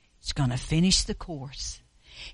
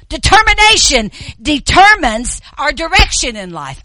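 preaching; 9 semitones higher, 11 dB louder than usual. Real, not acted. Preacher repeating theme loudly.

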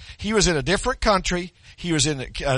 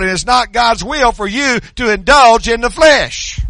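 preaching at full force; 3 semitones higher, 8 dB louder than usual. Acted. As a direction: preach it out to the church, full volume. Reads scripture then preaches loud.

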